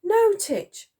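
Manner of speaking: put-on high girlish interjection; piping up. A narrator's reading. Girl encouraging the soldier, piping up. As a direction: pipe up brightly in a high voice. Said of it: "piped up Elsie".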